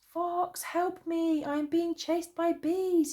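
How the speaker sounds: high, loud cry for help; frantic shout. A narrator's reading. Bear, chased by bees, shouts high and loud to Fox. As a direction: call out desperately across the distance. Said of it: "he shouted".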